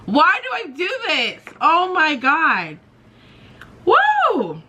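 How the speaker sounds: high-pitched, fighting back tears; exasperated at getting emotional, voice way up; about to cry. Real, not acted. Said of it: "I'm about to cry".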